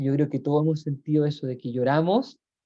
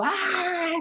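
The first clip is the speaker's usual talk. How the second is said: mock wail. Acting out crying aloud.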